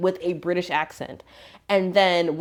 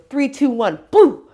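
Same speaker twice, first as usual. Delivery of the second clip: higher countdown bursting on BOO; playful countdown; 7 semitones higher, 6 dB louder than usual. Retold or imitated. Friend imitating man helping with parking, playful. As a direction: imitate a showy magic-trick countdown. Acts out man's silly countdown trick.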